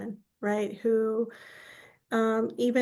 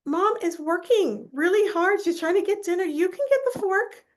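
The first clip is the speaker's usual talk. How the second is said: higher, louder, indignant telling-off; scolding dad; 8 semitones higher, 4 dB louder than usual. Retold or imitated. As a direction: set him straight, half laughing. Daughter scolding dad at dinner.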